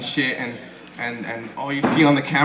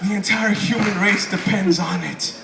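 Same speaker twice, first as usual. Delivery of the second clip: hammed-up, high breathy doom prophecy; dramatic time traveler; 7 semitones higher, slower than usual. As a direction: sell the end of the world, laughing through it. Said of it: "he's like".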